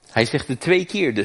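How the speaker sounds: normal talking voice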